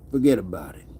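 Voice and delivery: low movie Italian-American "Forget about it"; movie Italian accent. Pretend. Streamer trying out the movie Italian accent, low. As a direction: say it like a movie wiseguy. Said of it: "That's another accent maybe I should work on".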